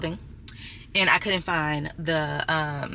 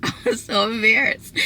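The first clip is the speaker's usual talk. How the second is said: embarrassed laugh; 4 semitones higher than usual. Real, not acted. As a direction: laugh it off while admitting it. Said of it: "It was so embarrassing".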